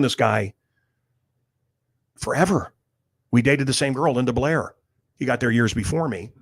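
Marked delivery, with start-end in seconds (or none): none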